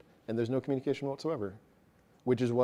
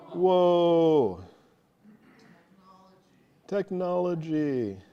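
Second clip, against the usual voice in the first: drawn-out, higher-pitched mock awe; mock amazement; 6 semitones higher, 6 dB louder, much slower than usual. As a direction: marvel playfully, stretching the words out.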